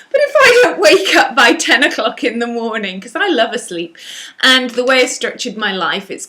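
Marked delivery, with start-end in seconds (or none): 0.1-3.0 louder, higher, laughing through it; laughing admission. Real, not acted. Admitting a late wake-up time to viewers, laughing. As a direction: confess it through a real laugh.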